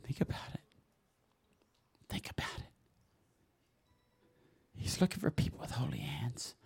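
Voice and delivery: whispered, soft reflective prompt; hushed whisper. Real, not acted. Preacher asks congregation to rest and think, whispering. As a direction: whisper it, leaving room to reflect.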